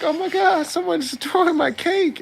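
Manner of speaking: very high, whiny put-on voice; mock high-pitched whine. Retelling the anime, the man whines about his cake in a very high voice. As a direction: whine it way up like a cartoon. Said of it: "And he's like".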